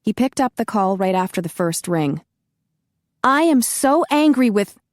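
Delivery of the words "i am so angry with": angry, higher and louder; heated outburst at him. Acted. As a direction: let the anger burst out on the phone. Heroine furious on the phone.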